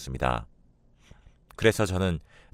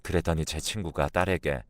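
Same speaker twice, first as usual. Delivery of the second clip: plain reading voice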